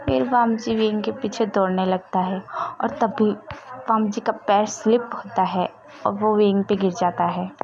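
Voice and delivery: plain reading voice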